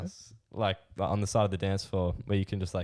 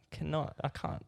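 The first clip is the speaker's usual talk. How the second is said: mock helpless; 5 semitones higher, slower than usual. Drunk self voiced in story.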